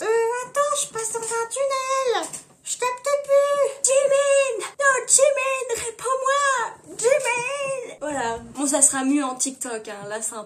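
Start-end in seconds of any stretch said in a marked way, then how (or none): 0.0-3.7 very high fake-excuse voice; high, stretched mock dropout. [name] pretends to lose connection. 3.8-8.0 high, loud drawn-out wail; mock desperate shout. Acting desperate call after dropout.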